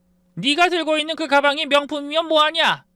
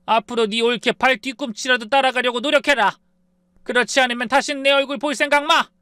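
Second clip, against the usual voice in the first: furious scolding; 8 semitones higher than usual. Acted. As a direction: pile on the shame. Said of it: "제가 더 몰아붙였습니다".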